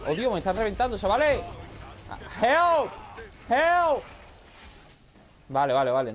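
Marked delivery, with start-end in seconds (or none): 2.4-4.0 high, loud panicked cry of help; yelling for help. Real, not acted. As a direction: shout it out as you get overrun. Getting wrecked in the game. (grito de auxilio agudo y fuerte)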